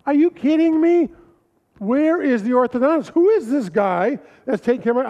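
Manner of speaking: a flustered single father reliving the shock; exasperated disbelief. Retold or imitated. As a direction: play the panicked dad who can't believe it. Father panics about orthodontist.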